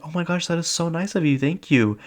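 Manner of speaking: high-pitched, grateful gushing; imitated gushing thanks. Woman thanking a friend for a present, imitated gushing. Acting victim's thanks for fake gift.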